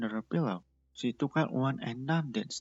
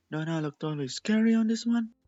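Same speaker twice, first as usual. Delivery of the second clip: higher and louder, showing off; proud playful boast; 10 semitones higher, 8 dB louder than usual. Acted. Cotton Candy boasting to her pet Gummy, high and loud. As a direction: crow over the scary face. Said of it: "She shoved a picture".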